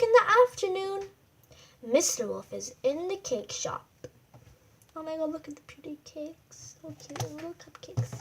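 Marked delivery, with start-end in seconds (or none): 5.0-8.1 soft gushing aside. Real, not acted. Aside admiring pictured cakes.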